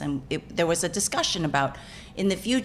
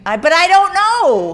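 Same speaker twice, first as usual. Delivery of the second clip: high, loud comic wail; flustered protest; 13 semitones higher, 11 dB louder than usual. Real, not acted. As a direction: throw up a loud, high-pitched plea of ignorance. Flustered protest over possibly offensive words.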